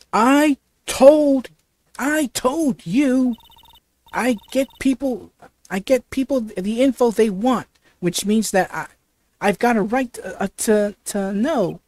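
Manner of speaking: a pushy southern reporter staking her claim; southern accent. Acted. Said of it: "That southern accent".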